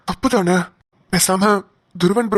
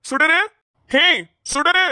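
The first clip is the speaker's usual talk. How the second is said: higher, raised call across the house; 7 semitones higher, much slower than usual. Acted. Father calls out for his daughter to come.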